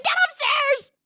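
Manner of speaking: very high, loud order; mock mom screech. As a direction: screech the command in panic. Retold mother ordering son away.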